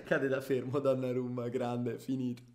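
rising, shouted football commentary; live play-by-play. Acted. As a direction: call the attack like a TV commentator. Final seconds commentary.

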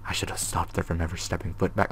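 hushed, voiceless read of the note; whispered reading of a scared diary. Acted. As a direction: breathe the words out like a secret.

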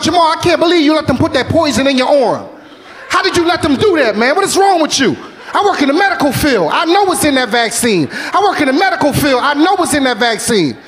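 high, heated cousin ranting; angry cousin. Retold or imitated. Cousin cussing him out over the vaccine, high and heated. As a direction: rant at him like the outraged cousin. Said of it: "cussed me out".

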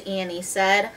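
plain reading voice

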